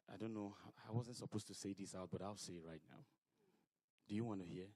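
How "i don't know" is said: normal talking voice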